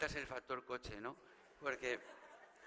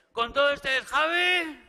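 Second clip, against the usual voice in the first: higher, louder shouted name call; rehearsal shout; 10 semitones higher, 12 dB louder, slower than usual. Shown as a demonstration. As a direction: belt the name out to the hall. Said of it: "hacemos la prueba".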